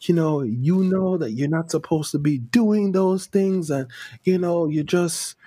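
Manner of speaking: high scolding churchgoer voice; preachy cousin. Retold or imitated. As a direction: lecture them like a pious cousin.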